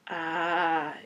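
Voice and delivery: drawn-out awkward hesitation; sheepish filler; mildly embarrassed. Acted. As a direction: stall with an awkward little sound. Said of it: "he appeared mildly embarrassed".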